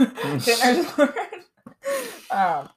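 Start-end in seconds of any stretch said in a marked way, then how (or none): 0.0-1.1 laughing through the words. Real, not acted. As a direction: giggle it out slowly. Laughing after own joke.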